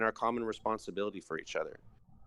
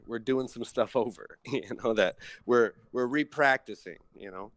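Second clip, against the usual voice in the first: usual speaking voice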